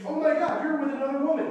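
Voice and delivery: loud, high outraged gasp; indignant. Pretend. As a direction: demand an answer, scandalised.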